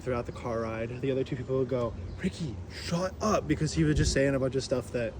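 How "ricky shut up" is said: drawn-out, weary telling-off; mock exasperated passengers, slowly. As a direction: stretch it out to scold an annoying friend.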